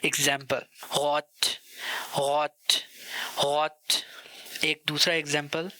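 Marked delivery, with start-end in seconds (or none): 1.0-3.8 हॉट with the r from the throat; हॉट with a guttural German r; throaty r. Shown as a demonstration. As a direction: growl the start of हॉट in the throat. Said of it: "थोड़ा throat से आवाज़ निकालनी होती है".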